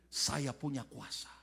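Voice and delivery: quiet gloating. Retold or imitated. Child gloats after teacher falls.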